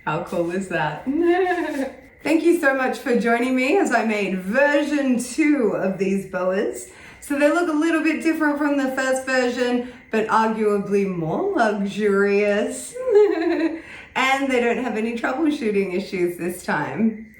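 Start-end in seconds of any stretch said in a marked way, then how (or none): 1.1-1.9 high laugh. Real, not acted. Laughs at own joke. 13.0-14.1 high laugh. Real, not acted. Laughs after luxurious.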